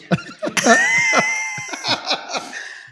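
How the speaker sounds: high shrieking laugh. Real, not acted. Laughing at friend's suffering.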